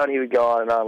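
ordinary talk

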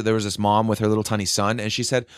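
usual speaking voice

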